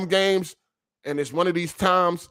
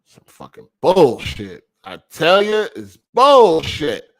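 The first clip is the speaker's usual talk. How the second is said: loud, slow, fed-up outburst; angry; 2 semitones higher, 9 dB louder, slower than usual. Real, not acted. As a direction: drag out bullshit in disgust. Stream keeps lagging.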